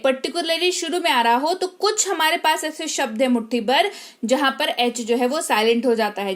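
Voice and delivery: ordinary talk, nothing marked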